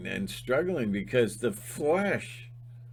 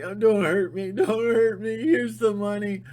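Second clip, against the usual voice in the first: higher, pleading coward voice; mock whimpering; 10 semitones higher, 5 dB louder than usual. Mocking old Timmy begging the bully, higher whine. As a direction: whine like a scared kid handing over cash.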